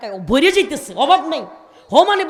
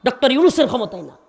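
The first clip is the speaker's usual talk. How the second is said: loud, higher, shouted preaching; full-force Bengali waz preaching; fiery sermon; 5 semitones higher, 8 dB louder than usual. Real, not acted. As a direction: rouse the crowd with booming verses. Fiery preaching with Quranic recitation.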